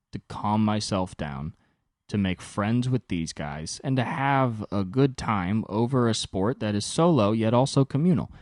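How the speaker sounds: ordinary talk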